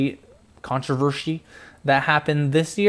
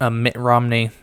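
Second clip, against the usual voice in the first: ordinary talk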